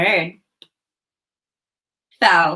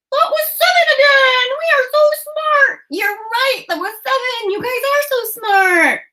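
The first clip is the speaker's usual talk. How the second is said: excited puppet cheer. Acted. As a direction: shout the praise like a thrilled puppet.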